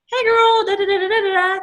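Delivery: high singsong chatter, trailing into nonsense syllables; chirpy mock greeting. Woman demonstrates a quick voice message, chirpy and singsong. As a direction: sing out a breezy mock voice message.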